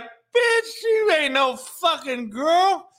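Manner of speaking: high, slow, sneering voiced quote; voicing a disgusted woman. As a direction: give her disgusted thought, drawn out. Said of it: "She like".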